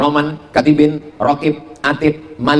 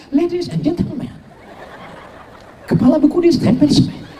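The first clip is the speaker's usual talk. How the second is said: airy, high-pitched cabin announcement; mock a seductive flight attendant with far too much breath; breathy announcer parody; 10 semitones higher than usual. Preacher imitates airport and plane announcements, breathy and sultry. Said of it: "Kenapa mesti ada angin-anginnya gitu?".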